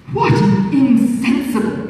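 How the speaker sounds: mock-polite flirtatious suitor; a hint of an insinuating beau; foppish gallant. Suitor's voice in read novel.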